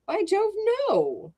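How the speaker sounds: theatrical upper-class exclamation; hearty exclamation. Acted. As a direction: boom it out like an amused old clubman. Trevor laughing off the idea.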